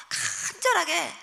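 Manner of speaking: 간절하게 drawn out slow and pressed; earnest emphasis. Shown as a demonstration. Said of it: "간절하게 하나님 앞에 기도하는데요". (간절하게를 천천히 힘주어)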